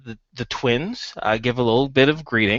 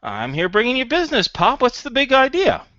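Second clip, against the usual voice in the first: high-pitched, quick, eager pitch; sheepish son voice, pitched well up; 12 semitones higher than usual. Acted. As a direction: greet Pop with a sheepish smile, high and hurried. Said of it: "sheepish smile. He says".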